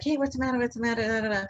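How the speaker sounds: lightly mimicked Italian accent, rattling off a question. Short Italian stranger greets stranded couple, mimicked Italian accent. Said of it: "He's got that Italian accent".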